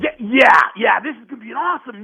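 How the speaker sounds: high, loud, hammed-up gushing; mock excited producer. Fighter mimics the producers, gushing and high. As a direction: gush over an injury like a TV boss. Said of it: "mocking producers' glee".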